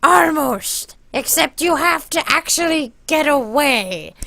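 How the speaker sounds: high, drawn-out character voice; Spoons. Retold or imitated. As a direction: explain slowly in a high cartoonish voice. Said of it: "And then he goes".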